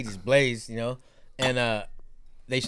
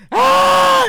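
long, loud, very high shriek; piercing mock child's shriek; imitated kid scream; 12 dB louder than usual. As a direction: scream like a terrified small child losing it. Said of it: "Just loses it".

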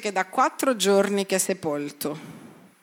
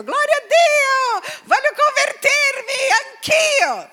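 awestruck convert; 4 dB louder, slower than usual. Retold or imitated. Crowd moved by seeing Lazarus.

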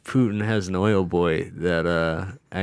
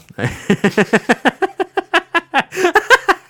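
spluttering laugh; 5 dB louder than usual. Real, not acted. [name] chokes on Red Bull.

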